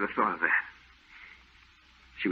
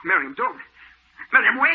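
rising, louder panicked cry; frantic plea; 6 dB louder than usual. Acted. Husband pleading with his mad wife to stop. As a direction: beg her to stop, rising fear.

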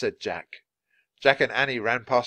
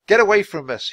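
high, loud warning; shouting; 9 semitones higher, 9 dB louder than usual. Acted. Cornered boy to the crowd, shouting. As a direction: shout to drive them back. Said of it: "he shouted".

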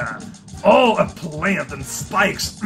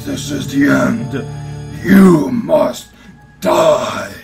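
slow theatrical villain menace; 7 semitones lower, slower than usual. Acted. Villain threat.

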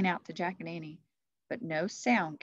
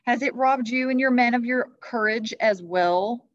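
higher, louder goading question; taunting challenge; 6 semitones higher, 10 dB louder than usual. Acted. Christmas Knight goading King Arthur, taunting. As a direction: needle him about his courage.

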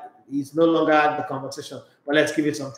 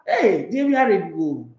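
ordinary talk

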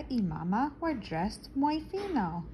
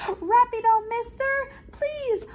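frantic plea; 13 semitones higher, 5 dB louder than usual. Acted. Dad pleads with stranger urgently.

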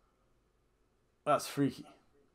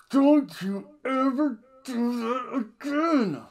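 scolding daughter; 10 semitones higher, 4 dB louder, slower than usual. Retold or imitated. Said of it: "Charlotte confronted her dad".

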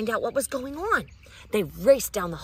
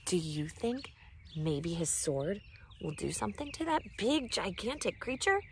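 ordinary delivery

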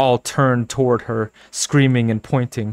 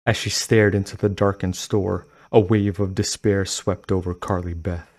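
ordinary delivery, nothing marked

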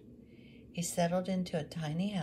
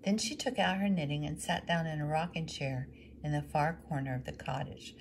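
ordinary delivery, nothing marked

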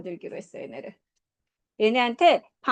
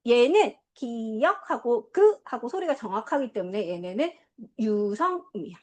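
normal talking voice

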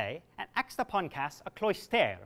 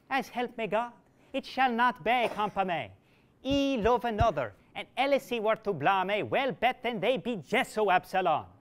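higher, impatient woman's voice; sharp refusal; 4 semitones higher, 4 dB louder than usual. Acted. Alisoun rejecting Absalom, higher and impatient. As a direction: send him packing, rising to a shout.

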